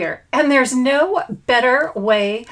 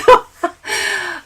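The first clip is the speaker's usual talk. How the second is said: laugh; 7 semitones higher, 3 dB louder than usual. Real, not acted. Laughs after greeting.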